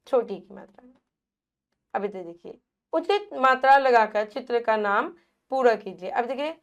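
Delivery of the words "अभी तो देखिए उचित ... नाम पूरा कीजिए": ordinary talk, nothing marked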